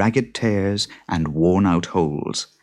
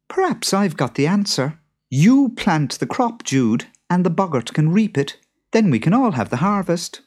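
much higher, lighter wife voice; higher-voiced wife with a plan; clever wife; 10 semitones higher, 4 dB louder than usual. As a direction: propose the deal brightly. Beth voiced.

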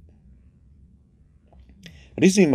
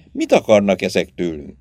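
ordinary delivery, nothing marked

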